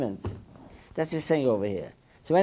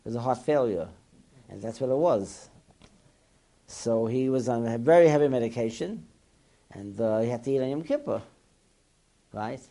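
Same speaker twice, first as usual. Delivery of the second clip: ordinary talk, nothing marked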